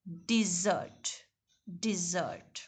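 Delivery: "डिजर्ट" slow and soft, stress on ZERT; soft, slow di-ZERT for the sweet; dessert, di-ZERT. Shown as a demonstration. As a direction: model "डिजर्ट" gently, second syllable strong. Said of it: "sweet है जो हम खाने के बाद".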